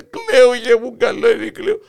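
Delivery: put-on crying old man. Grandpa explains through tears.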